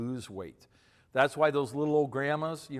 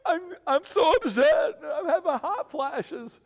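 high, loud comic blubbering; hammed-up tearful stammer of a weeping dad; mock crying; 8 dB louder than usual. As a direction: wail it like an upset tough guy. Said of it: "is sitting here crying".

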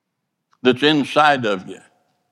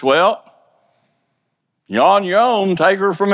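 dismissive voice; 5 semitones higher than usual. Pretend. God voiced as abandoning guide.

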